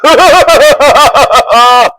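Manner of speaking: high, loud hearty laughter; delighted laugh. Acted. Brigand recognizing his old friend, delighted laugh. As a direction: burst out laughing with joy. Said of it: "The brigand laughed delightedly".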